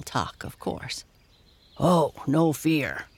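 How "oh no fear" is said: slow, put-on bear voice; exclaiming bear. A narrator's reading. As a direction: exclaim as a storybook bear, drawn out. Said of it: "exclaimed Dido".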